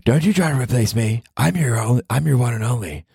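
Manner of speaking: put-on jealous girlfriend warning him off a real one, high and possessive; fake mean girlfriend. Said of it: "a fake mean girlfriend voice".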